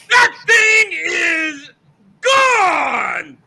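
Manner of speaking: radio home run call, building from hushed to a shout; John Miller home run call. Acted. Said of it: "doing his best, John Miller".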